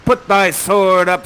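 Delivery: loud, slow and grand; heroic challenge. Acted. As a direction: call him out to fight. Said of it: "He says".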